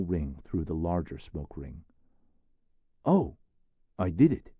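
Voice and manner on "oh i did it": high, loud burst of delight; boyish exclaim. Acted. Therese thrilled his smoke ring worked, high boyish exclaim. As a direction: crow with a boy's pride at the trick. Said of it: "he exclaims".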